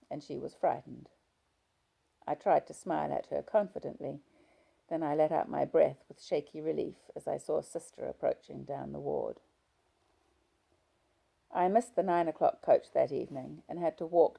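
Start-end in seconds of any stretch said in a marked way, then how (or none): none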